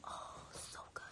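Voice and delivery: breathy whisper of delight. Real, not acted. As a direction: whisper it like a secret treat. Whispering about sushi.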